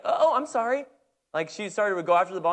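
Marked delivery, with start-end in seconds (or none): 0.0-0.9 high, light apologetic voice; timid apology. Retold or imitated. Daughter apologizing on the field, high and timid. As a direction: sound like a shy girl saying sorry. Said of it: "she was like".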